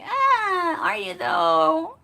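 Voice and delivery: high, drawn-out mock disbelief; sarcastic doubt. To the drama girl, high mocking disbelief. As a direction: tease her, voice pitched high and slow. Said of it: "And I'm like".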